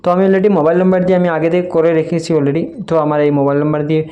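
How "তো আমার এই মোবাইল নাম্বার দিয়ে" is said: ordinary talk, nothing marked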